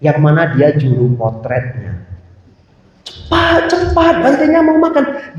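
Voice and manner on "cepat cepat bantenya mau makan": high-pitched imitation of pestering adults; acted-out nagging, pitched well up; urgent pressing. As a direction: hurry someone along in a high voice. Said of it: "guru-guru mendesak satu anak".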